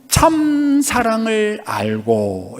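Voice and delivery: very slow, 참 held long; solemn emphasis. Real, not acted. Preacher defining true love for church, slow emphasis. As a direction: stretch 참 out, then measure each word. Key phrase stretched for emphasis.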